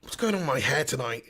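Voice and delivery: normal talking voice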